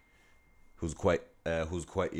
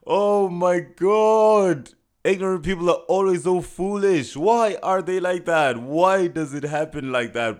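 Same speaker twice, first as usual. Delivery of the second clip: high, loud, drawn-out theatrical lament; mock exasperation; 10 semitones higher, 9 dB louder, slower than usual. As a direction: wail it like a scandalised aunt. Mocking outburst about ignorant people.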